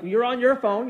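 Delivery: mildly acted accusation; accusing. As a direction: accuse them, as if starting a spat. It blows up into a big old fight.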